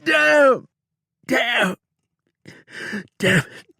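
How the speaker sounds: high, loud pained curses; hurting outlaw cursing, high and loud; panting curses. A narrator's reading. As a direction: spit out the curses through pain. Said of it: "he said, panting".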